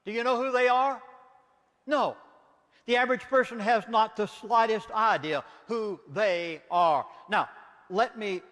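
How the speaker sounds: normal talking voice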